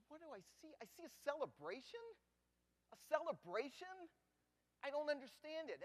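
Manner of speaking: high disbelieving question; can't believe his eyes; incredulous. Acted. Disbelief at the celebration.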